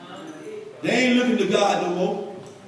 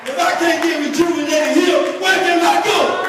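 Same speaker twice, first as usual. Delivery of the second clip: higher and louder, building question; rising preaching; 5 semitones higher, 3 dB louder than usual. Acted. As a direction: build it up and call out to the church. Climbing preaching.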